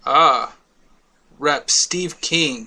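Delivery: usual speaking voice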